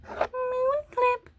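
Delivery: very high, cutesy singsong; silly high-pitched voice. Pretend. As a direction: play with the clip names in a high, drawn-out squeak. Same silly clip voice.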